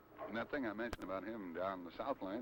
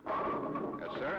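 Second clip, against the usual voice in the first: breathy, louder burst of approval; excited exclamation; 4 semitones higher, 5 dB louder than usual. Real, not acted. Announcer cheers Dick Hoover's double, excited.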